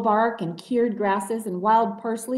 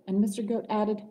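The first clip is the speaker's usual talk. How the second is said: ordinary delivery, nothing marked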